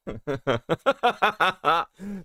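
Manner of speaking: rising, high-pitched giggling fit; amused laughter. Real, not acted. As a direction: crack up for a moment. Laughs at a joke message.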